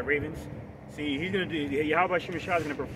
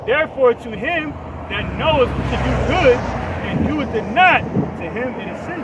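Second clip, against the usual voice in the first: loud, slow, high preaching read of the verse; scripture reading; 8 semitones higher, 12 dB louder than usual. Acted. Preacher reading a verse to his friend, loud and proclaiming. Reads a Bible verse aloud.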